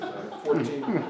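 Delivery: a laugh breaks into fourteen. Real, not acted. Laughing at the list.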